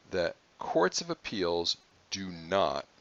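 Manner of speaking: slow, with do not leaned on hard; stress on not. Shown as a demonstration. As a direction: drive home the word not. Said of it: "I can't emphasize that word".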